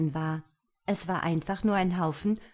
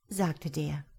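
ordinary delivery, nothing marked

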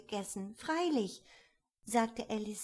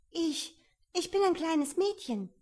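unsure; 9 semitones higher, 6 dB louder than usual. A narrator's reading. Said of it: "sagte Alice etwas unsicher".

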